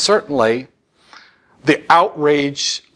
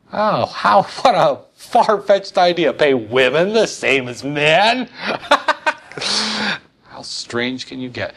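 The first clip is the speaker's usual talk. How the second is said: sarcastic mock shock; 5 semitones higher, slower than usual. Real, not acted. Sarcastic mock surprise at equal pay.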